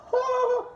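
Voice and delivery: a short, high burst of laughter; sudden high laugh. Real, not acted.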